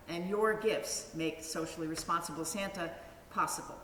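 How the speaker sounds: ordinary delivery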